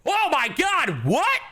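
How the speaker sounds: high slow shocked disbelief. Real, not acted. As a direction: gasp it out in disbelief. Shocked reaction to game line.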